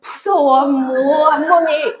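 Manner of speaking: put-on whiny scared child. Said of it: "내가 무섭다는 거야".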